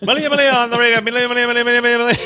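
high mock chant of a name; hammed-up babbling. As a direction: rattle the name off high, breaking into laughter. Mimicking Tom's babbling.

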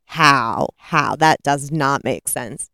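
low, deliberate incredulity; baffled disbelief. Real, not acted. Reviewer puzzled by twenty letters arriving, baffled disbelief. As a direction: drop low and spell out your disbelief. Baffled at impossibly fast mail.

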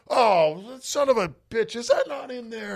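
high, put-on cursing father; high mock-angry dad grumble; mad dad. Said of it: "he's getting all mad".

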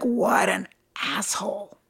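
soft, slow, voiceless under-the-breath remark; muttered aside. Retold or imitated. Leaving the office, she mutters about her boss. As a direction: say it under your breath, to yourself. Private thought after the rebuke.

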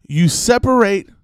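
ordinary talk

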